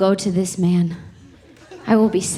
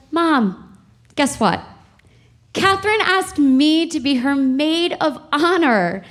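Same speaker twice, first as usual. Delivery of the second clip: higher, eager announcement; excited; 7 semitones higher, slower than usual. Retold or imitated. Daughter calls mom with big news, excited and higher. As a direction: burst with the happy news. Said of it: "I called her so excited".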